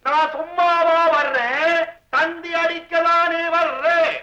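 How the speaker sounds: slow, every word stretched out; drawn-out repeat. Acted. Repeating himself to a listener who said "huh", slow and drawn out. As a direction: stretch each phrase for someone who missed it.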